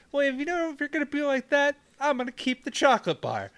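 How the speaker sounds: high, petulant voice; high sulky Joey. Retold or imitated. Friend imitates Joey's high, sulky huff as he walks off. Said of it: "and it's like".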